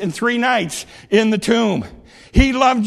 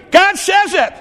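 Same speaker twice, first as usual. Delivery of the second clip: higher, louder declaration; preacher insists to the congregation, loud and high; preaching shout; 7 semitones higher, 4 dB louder than usual. Acted. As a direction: proclaim it to the whole church. Preacher's refrain at full force.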